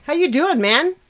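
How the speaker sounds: high, loud friendly hello; cheery greeting. Real, not acted. Greeting her cat with a high loud hello.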